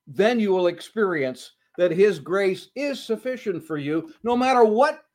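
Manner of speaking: high, loud emphatic preaching; full-force preaching. Acted. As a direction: drive the point home to the congregation.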